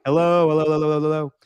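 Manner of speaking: loud radio host hellos; morning show mic check. Pretend. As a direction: boom it out like a morning DJ. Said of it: "Yep, let's do it".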